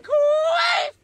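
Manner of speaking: the name flung out high and loud; rowdy sign-off yell; shout-out. Real, not acted. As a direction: yell the last name like a cheer.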